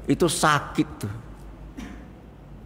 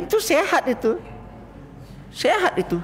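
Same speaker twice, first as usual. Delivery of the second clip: emphatic. Real, not acted. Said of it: "emphatic claim".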